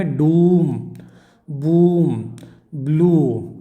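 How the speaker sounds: डूम, बूम, ब्लू with a long "oo"; drawn-out "oo" in डूम, बूम, ब्लू; long vowel demo. Shown as a demonstration. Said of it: "इसको क्या बोलेंगे".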